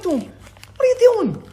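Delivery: high and slow, exasperated big brother; mock exasperated scolding. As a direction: drag it out in disbelief. Scolding brother over water.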